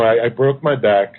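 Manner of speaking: normal talking voice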